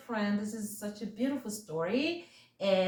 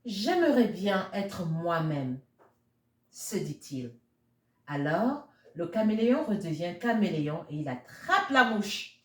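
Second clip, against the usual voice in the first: French story reading; 4 dB louder than usual. A narrator's reading. Reading the French version to children, lilting storyteller voice. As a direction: tell the tale to little ones in French.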